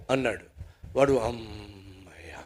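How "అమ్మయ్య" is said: very slow, low, hushed exhale; long, soft, relieved murmur; sigh of relief. Acted. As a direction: let the word drain out in relief. Forgiven man sighs with relief.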